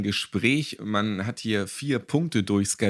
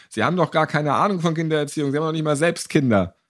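high, loud and fast accusing outburst; angry parent; 7 semitones higher, 4 dB louder, faster than usual. Retold or imitated. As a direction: snap it at the teacher like an indignant father. (empörter Vater, der die Erzieherin laut und schnell anfährt)

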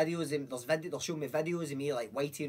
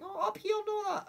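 put-on much higher kid voice; imitating a wee boy. Wee boys giving directions, piping high voice. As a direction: pipe up like a small boy.

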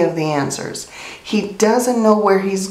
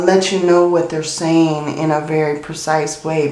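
ordinary talk

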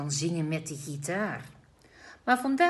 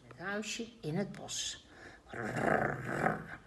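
growling wolf; 5 dB quieter, slower than usual. A narrator's reading. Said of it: "bromt de wolf".

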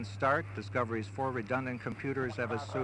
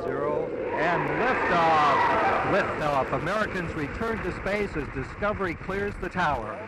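excited launch call; 4 semitones higher, 7 dB louder than usual. Real, not acted. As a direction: call the liftoff with rising excitement. Launch commentator calls the liftoff.

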